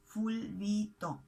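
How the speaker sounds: fulbito drawn out slowly as ful-bi-to; slow syllable-by-syllable. Shown as a demonstration. Teacher repeating Argentinian slang for learners, fulbito slow and syllable by syllable.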